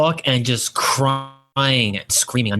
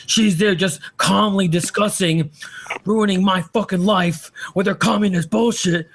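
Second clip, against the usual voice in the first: higher, mocking take on a whiny rant; imitating Cantwell ranting; 8 semitones higher than usual. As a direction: lightly mimic an aggrieved drunk host, pitched up. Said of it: "the way he stated".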